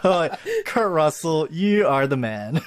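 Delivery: drawn-out gushing praise through laughter; excited fan gush. Real, not acted. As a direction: lay it on like a starstruck fan. Fan gushing over Kurt Russell.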